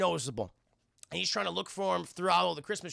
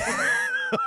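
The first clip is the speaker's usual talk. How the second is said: crazed laugh; 5 dB louder than usual. Retold or imitated. Mel laughing madly at cops.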